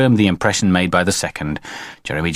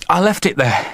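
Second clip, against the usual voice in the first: put-on high, breathy-from-running child voice; high-pitched child; small boy; 8 semitones higher than usual. A narrator's reading. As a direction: answer like an upset little boy. Boy answers father after scare.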